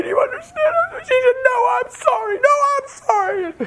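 high crying shout; tearful pleading. Retold or imitated. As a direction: plead through sobs. Tearful exchange with wife.

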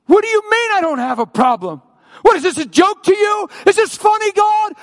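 outraged high-pitched shouting; acted rage, loud and very high; angry yelling at God. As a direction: yell it in furious disbelief. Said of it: "like I yelled at God".

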